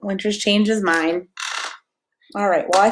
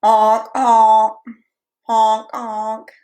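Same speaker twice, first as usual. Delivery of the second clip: loud honking goose call; playful goose honks; 6 dB louder, much slower than usual. Shown as a demonstration. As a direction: honk like a goose for the baby. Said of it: "what sound does a goose make".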